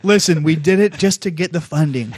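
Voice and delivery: usual speaking voice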